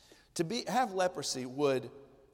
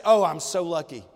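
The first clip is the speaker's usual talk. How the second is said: louder, a hammed-up cry of luck; mock excited exclamation; 10 dB louder than usual. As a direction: play a leper bragging about his luck, loud and light. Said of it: "He doesn't say".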